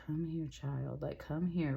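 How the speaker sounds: soft, gentle beckoning. Retold or imitated. Reader relays a voice calling a child, very gently. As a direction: softly imitate a tender voice calling someone close. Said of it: "very gentle voice just came off".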